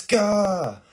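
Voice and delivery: high, loud "gah"; startled yell. Acted. Assassin loosing an arrow at girl, loud panicked yelp. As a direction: burst out in mock panic.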